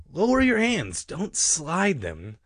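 high, emphatic instruction from above; advising. A narrator's reading. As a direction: call down firm advice, stretching "slide". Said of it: "Sorry advised".

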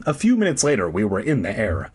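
ordinary talk

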